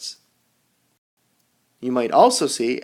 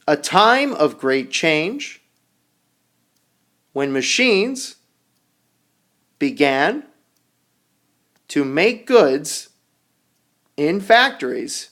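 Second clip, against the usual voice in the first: reading aloud to students; slower than usual. Acted. Reading definition aloud to class.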